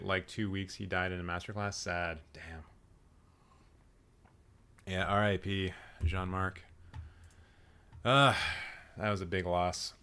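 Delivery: low, soft and subdued; somber aside. Real, not acted. Vlogger mourns the late director in a low, soft voice. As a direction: drop your voice for a sad aside.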